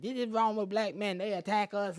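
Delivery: hammed-up outraged woman; imitating a woman crying foul; mock accusing woman. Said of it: "I don't want them to be like".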